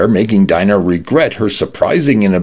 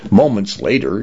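ordinary delivery, nothing marked

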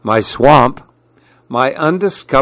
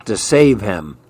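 ordinary delivery, nothing marked

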